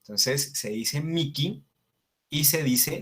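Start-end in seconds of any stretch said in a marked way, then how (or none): 1.0-1.6 Miki said "MEE-kee", h as k; Miki, k for the written h; Latin pronunciation. Shown as a demonstration. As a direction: say Miki with a clear k. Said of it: "la H se pronuncia como una K".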